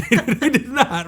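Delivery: laughing through the words; amused, cracking up. Real, not acted. Laughing about failed sales.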